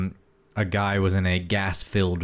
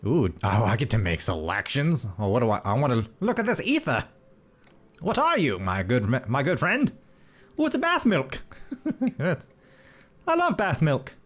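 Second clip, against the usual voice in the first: put-on high, creaky old-timey codger; old man voice; 9 semitones higher than usual. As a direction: play a fussy old-timer. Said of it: "use my old man voice".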